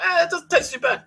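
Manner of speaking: squeaky, high-pitched self-parody; mock high voice. Tasting his bachelor pasta, in a squeaky high mock voice. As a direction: pitch it way up like a cartoon taster.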